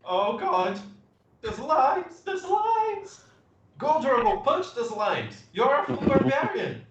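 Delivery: high-pitched panicky cries; acted panic. Player urging Drogo to punch the slimes, high acted panic.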